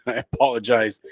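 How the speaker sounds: a sheepish laugh into sorry; chuckling apology. Real, not acted. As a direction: laugh it off, embarrassed. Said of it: "I can't even talk".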